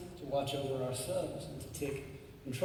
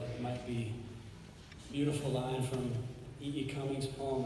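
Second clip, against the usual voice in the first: ordinary talk, nothing marked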